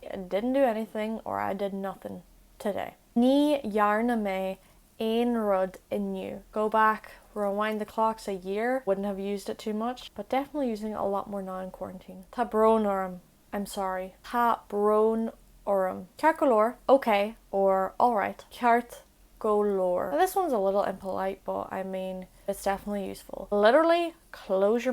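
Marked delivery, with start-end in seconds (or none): 3.2-6.4 slow it down so learners catch each word; slow teaching repeat. Shown as a demonstration. Slow Irish phrase for learners. 14.2-16.1 slow teaching repeat. Shown as a demonstration. Teacher repeats Tá brón orm slowly for learners. 18.5-20.1 slow teaching repeat. Shown as a demonstration. Teacher slows Ceart go leor for learners.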